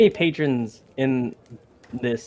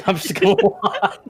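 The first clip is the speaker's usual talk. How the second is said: laughing; 8 semitones higher than usual. Real, not acted. Laughing confession at table.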